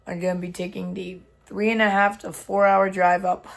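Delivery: hoarse, strained lost voice. Real, not acted. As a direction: speak through a lost voice. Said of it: "I've lost my voice".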